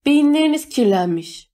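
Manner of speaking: high-pitched, scornful call; shouted insult. A narrator's reading. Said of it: "diye bağırdı Eno". (tiz sesle bağırarak hakaret)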